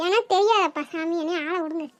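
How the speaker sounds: mock-cry to get attention; whimpering. Child fake-crying to be left alone, whimpering softly. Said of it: "நம்ம அழுகிறத கண்டுக்காம".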